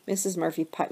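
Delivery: plain reading voice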